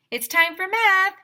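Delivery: high, loud teacher call; teacher announcing. Acted. Teacher announcing math to the class, high and loud. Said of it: "he said".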